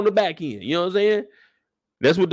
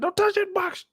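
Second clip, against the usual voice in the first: much higher, mock stern voice; stern father. Father warning his son, very high mock stern. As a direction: lay down the law, hammed up. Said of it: "He said".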